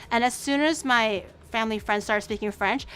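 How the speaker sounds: ordinary talk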